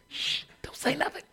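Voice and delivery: hushed whisper to the family. Retold or imitated. Family hiding from the doorbell, whispered. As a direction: hiss it so nobody at the door hears.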